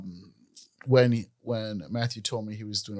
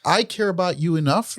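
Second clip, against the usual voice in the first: mock caring partner; 7 semitones higher, 7 dB louder than usual. Voicing caring partner, high and loud.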